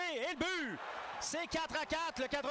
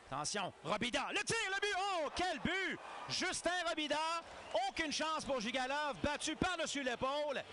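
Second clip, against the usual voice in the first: excited sports commentary; 2 semitones higher than usual. Real, not acted. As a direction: shout the goal with joy. Goal call.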